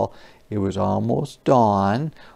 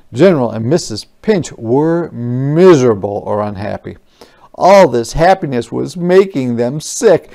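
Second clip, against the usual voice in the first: ordinary delivery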